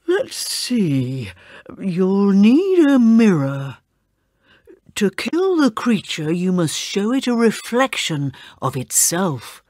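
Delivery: slow, measured wise voice; old priest. Acted. Priest tells the children how to kill it, slow wise voice. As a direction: advise them gravely. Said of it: "the priest said".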